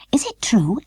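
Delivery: high, hushed whisper. A narrator's reading. A doll whispers about the queen, hushed. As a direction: breathe the question.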